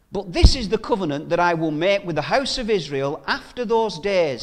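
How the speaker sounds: forceful Bible reading; scripture reading. Acted. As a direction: proclaim the verses to the congregation. Preacher reads scripture aloud.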